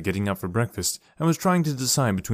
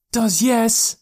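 put-on much higher, insistent little boy; stubborn boy; 12 semitones higher, 5 dB louder than usual. Boy stubbornly contradicts his father, in a higher childlike voice. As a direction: dig your heels in like a child.

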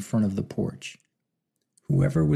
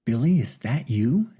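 incredulous. A narrator's reading. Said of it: "asked Martin incredulously".

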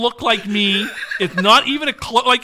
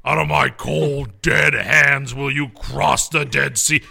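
slow, lower grandiose Heston voice; Charlton Heston impression; 6 semitones lower, slower than usual. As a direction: declaim it like Moses. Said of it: "Charlton Heston".

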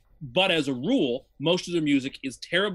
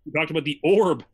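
louder, repeating it to be heard; raised voice, making sure it lands; 5 dB louder than usual. Real, not acted. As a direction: say it up louder for someone who missed it. Asked "What's that?", says it louder.

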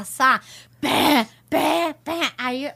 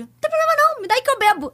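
high, eager plea to the security guard; high pleading plea; 13 semitones higher, 5 dB louder, faster than usual. Retold or imitated. Retold to friends, a high eager plea to the guard. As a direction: pitch it high, begging to drink it.

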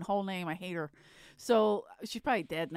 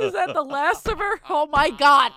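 louder, high, half-laughing half-crying; shocked laughter; 6 semitones higher, 11 dB louder than usual. Real, not acted. Host reacting to the grandmother's teeth story, laughing in horror.

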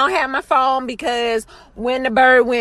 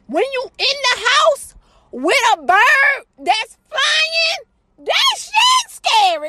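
shouted rant; 12 semitones higher, slower than usual. Real, not acted. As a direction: yell it like it's the scariest thing ever. Comic ranting about bird.

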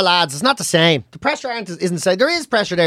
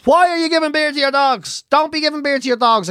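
mock scolding in a higher voice; scolding commenters; 7 semitones higher than usual. Online commenters telling off a dog owner, high-pitched mock scolding. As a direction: imitate outraged commenters, pitched up. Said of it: "I got slated and hammered over it".

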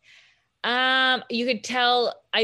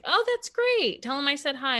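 much higher, sugary fake politeness; mock sweet reply; 9 semitones higher than usual. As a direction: brush them off with an overly sweet lilt. Sweet fake reply to drunk fans.

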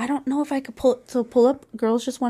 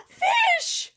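excited shout. Real, not acted. Both said the same word.

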